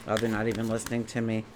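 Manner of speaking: usual speaking voice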